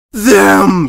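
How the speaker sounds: loud high accusing cry; panicked accusation, shouted; cried out. A narrator's reading. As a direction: point and shriek it. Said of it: "Press Pass cried".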